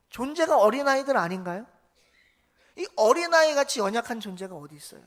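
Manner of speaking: loud, rising sermon appeal; impassioned preaching. Acted. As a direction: build the plea to the pews. Preaching at full force. (회중에게 호소하는 뜨거운 설교)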